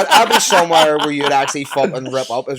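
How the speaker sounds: loud, laughing banter; rowdy, high-energy brag with a laugh; excited boast. Real, not acted. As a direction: shout it over the lads' laughter. Excited banter about partying in Rio.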